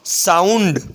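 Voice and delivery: સાઉન્ડ with an "ow" vowel after the s. Shown as a demonstration. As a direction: glide through "ow" in સાઉન્ડ. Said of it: "એટલે સ પછી આવ".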